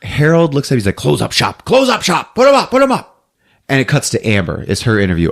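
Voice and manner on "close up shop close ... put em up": rising, high frantic command; mock urgent order. Harold telling Hank to cover up, mock urgent. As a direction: repeat it higher, in hammed-up urgency.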